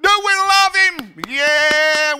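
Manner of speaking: high, loud call and response; rallying the crowd. Retold or imitated. Speaker rallies church to cheer for pastor, loudly excited. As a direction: whip the congregation up.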